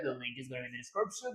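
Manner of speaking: fast announcer patter; fast read. Acted. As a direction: rattle it off quickly.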